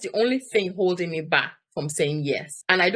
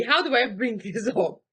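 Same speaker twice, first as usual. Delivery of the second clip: ordinary delivery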